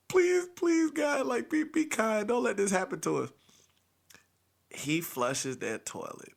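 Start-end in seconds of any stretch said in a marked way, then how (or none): 0.1-3.3 high, pleading prayer; desperate pleading. Retold or imitated. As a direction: beg God desperately.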